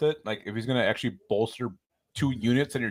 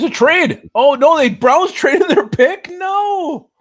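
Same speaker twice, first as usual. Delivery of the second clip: loud, high disbelieving cry; shocked shout; 9 dB louder, much slower than usual. Real, not acted. Shocked at surprise trade.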